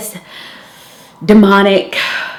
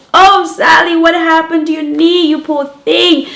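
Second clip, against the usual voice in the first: high, loud, gushing nurse voice; fussing nurse; 8 semitones higher, 3 dB louder than usual. Retold or imitated. School nurse fussing over Sally's scraped knee, high and gushing. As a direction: gush over the poor child's knee.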